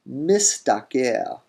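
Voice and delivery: mistaker drawn out slowly, mis-taker. Shown as a demonstration. Teacher repeating the real form, mistaker. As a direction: stretch mistaker, sibilant first. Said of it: "and you get".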